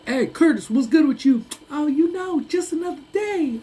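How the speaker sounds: mock the buff friend's tiny high voice; high voice on a huge guy. Streamer imitates his huge football friend greeting him in a high voice. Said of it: "Dude, muscled, man".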